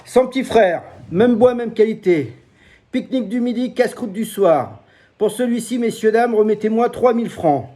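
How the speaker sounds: high, loud fairground sales patter; market hawker pitch. Shown as a demonstration. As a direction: sell it like a market barker. Knife seller's market pitch.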